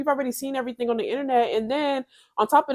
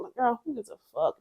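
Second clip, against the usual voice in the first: usual speaking voice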